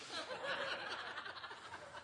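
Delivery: a burst of high laughter. Real, not acted. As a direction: laugh at the Culver's joke. Laughing at own joke.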